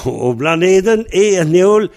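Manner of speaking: o blaneden et neol said high, rising on neol; high question. Shown as a demonstration.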